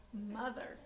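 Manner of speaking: ordinary delivery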